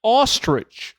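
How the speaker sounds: ostrich said with British vowel, high and punchy; mock British ostrich; British accent attempt. Shown as a demonstration. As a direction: try the UK way of saying ostrich. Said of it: "I'm trying to say it like you guys in the U K".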